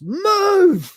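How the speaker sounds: high loud Move!; shouted move. Retold or imitated. Lister finally losing patience with Cat. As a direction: yell it at him. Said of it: "Lister shouts".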